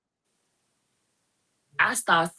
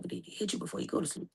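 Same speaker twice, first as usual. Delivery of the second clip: hushed; 13 dB quieter, faster than usual. Retold or imitated. Asking mom if she was hit.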